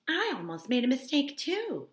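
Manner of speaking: high, loud declaring party guest; shrill Mrs McKee. A narrator's reading. Mrs McKee boasting to the party guests, loud and high. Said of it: "the shrill voice of Missus McKee".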